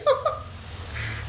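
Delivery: a sudden squeal of laughter, breathy; high squealing laugh. Real, not acted. Squealing with laughter at the sisters' table fight.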